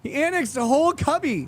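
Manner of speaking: high, disbelieving echo; incredulous. Real, not acted. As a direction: repeat it in shocked disbelief. Incredulous repeat of annexed.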